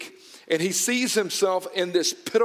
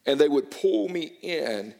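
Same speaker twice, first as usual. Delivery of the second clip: ordinary delivery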